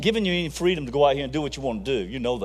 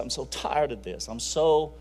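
retold crying; 4 semitones lower, 9 dB quieter than usual. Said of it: "And she's crying".

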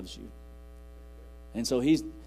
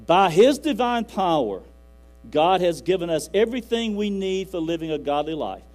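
preaching at full force; 3 semitones higher, 8 dB louder than usual. Acted. Preacher proclaiming to the church, booming and impassioned. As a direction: proclaim it to the congregation with fervor.

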